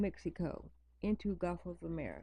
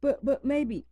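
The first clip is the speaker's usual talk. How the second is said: high, loud stammering mock doubt; mock "but, but maybe" said high and loud; mocking doubters; 7 semitones higher, 7 dB louder than usual. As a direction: mimic someone clinging to hope. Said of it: "people was like".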